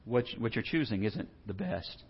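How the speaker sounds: soft quiet correction; gentle Jesus. Retold or imitated. Jesus softly correcting Martha, quiet and kind. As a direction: kindly tell her she's missed the best. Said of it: "He says".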